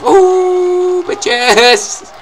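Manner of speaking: long high whoop, then shouted taunt; gleeful whoop. Real, not acted. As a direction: whoop it out loud, drawing the vowel. Celebratory whoop at crowd surf.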